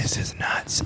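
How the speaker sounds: soft unvoiced whisper. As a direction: whisper it back conspiratorially. Whispered reply.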